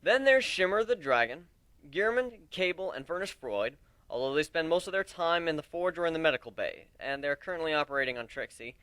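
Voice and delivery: loud, projecting captain's address; addressing a crowd. Acted. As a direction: project to the whole deck. Captain introducing crew to recruits.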